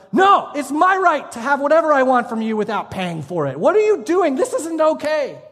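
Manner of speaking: high-pitched indignant protest, hammed up; mock outrage. Angry subscribers protesting to Netflix, mock outrage. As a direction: imitate an affronted customer, pitch climbing. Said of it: "they got mad".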